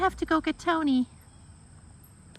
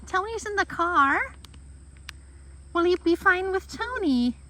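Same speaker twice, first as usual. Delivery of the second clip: coaxing a pet; 6 semitones higher, 6 dB quieter than usual. Real, not acted. Coaxing shaking dog.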